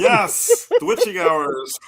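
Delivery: high squeal then slow spooky drawl. Pretend. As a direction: ham up the witching hours. Sleepy guest jokes in voice.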